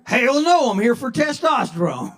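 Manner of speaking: loud, high indignant outburst; indignant denial. Acted. Old patient rejecting the clinic's term, indignant. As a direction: shout it back, deeply affronted.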